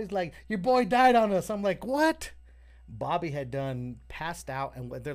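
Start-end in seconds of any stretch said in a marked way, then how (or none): 0.5-1.5 high, loud retold alarm; alarmed announcement. Storyteller voicing bandmate breaking news in the car, loud alarm. Acts Lazy announcing the death. 1.9-2.3 high, loud rising yelp; shocked disbelief. Retold or imitated. Storyteller reliving the news of his friend dying, loud shocked question.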